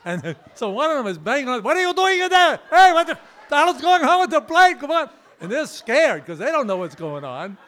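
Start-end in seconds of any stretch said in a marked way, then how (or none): 1.6-5.1 much higher, much louder panicked shouting; yelling cleaner. Retold or imitated. Scared cleaner in the back yelling at the pilot, high loud shout. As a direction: bang on the door and yell what's going on.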